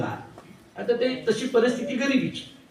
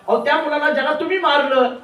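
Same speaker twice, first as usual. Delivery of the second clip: louder and higher, insisting; exasperated wife; 7 semitones higher, 5 dB louder than usual. Acted. Wife insisting to her husband the pandit, raised voice. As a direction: press him hard with the words.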